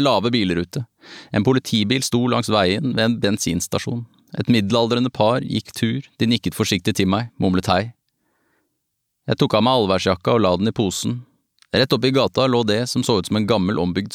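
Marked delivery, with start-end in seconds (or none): none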